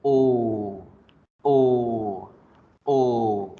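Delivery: long drawn-out O vowel; held English long O. Shown as a demonstration. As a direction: stretch each O out long. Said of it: "Alargo la O".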